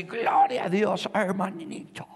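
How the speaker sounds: lightly voiced old brother, bright and slow; elderly brother's greeting. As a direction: greet like a joyful ninety-year-old. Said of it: "Y le habla así".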